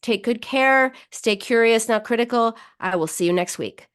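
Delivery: normal talking voice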